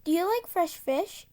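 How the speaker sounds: usual speaking voice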